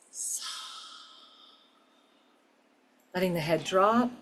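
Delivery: soft, unhurried meditation voice; guided meditation. Acted. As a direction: guide them gently inward.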